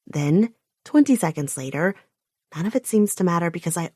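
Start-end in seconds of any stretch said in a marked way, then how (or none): none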